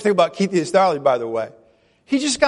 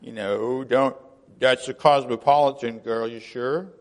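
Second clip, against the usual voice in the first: lower, put-on holier-than-thou tone; mock pious voice; 7 semitones lower, 3 dB quieter than usual. Preacher mocking pious prison ministers, lower mock-holy voice. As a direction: parody a prim, proper church lady. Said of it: "we didn't just say".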